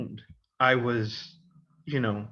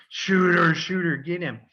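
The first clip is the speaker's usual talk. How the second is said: louder, higher hunting yell; mock chase shout; 4 semitones higher, 4 dB louder than usual. Jocks chasing the freshman down the stairs, yelling. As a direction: call out the prey like a mob.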